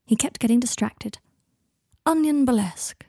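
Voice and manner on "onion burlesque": higher-pitched, playful musing; lightly theatrical pondering. A narrator's reading. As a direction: muse aloud as if naming a show. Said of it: "he mused".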